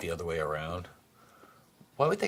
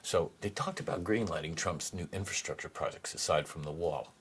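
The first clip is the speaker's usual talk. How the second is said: usual speaking voice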